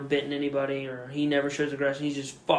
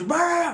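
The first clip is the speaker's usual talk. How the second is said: high rolled bark; imitated dog bark. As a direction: keep barking over the owner.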